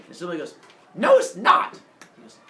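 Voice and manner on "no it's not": high, loud flat refusal; snippy denial. Acted. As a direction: shut him down like a brat. Semele denies Zeus.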